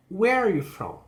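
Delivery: high, strong "Where", down to "from"; falling question. Shown as a demonstration. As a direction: stress "Where" and let it fall. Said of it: "Information question".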